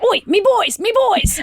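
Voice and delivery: high, cheeky lad greeting; Toomgus the lad. Acted. Toomgus welcomes his lads, high laddish call. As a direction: greet your boys like a proud ringleader. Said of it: "Toomgus is like".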